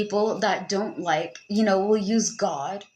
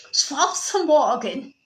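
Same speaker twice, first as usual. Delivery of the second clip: mock German with a Scottish old-man edge; old angry Scottish man; 3 semitones higher, 3 dB louder, slower than usual. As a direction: bungle the German like a grumpy Scotsman. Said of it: "sounded like an old, angry Scottish man".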